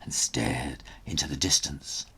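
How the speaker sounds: plain reading voice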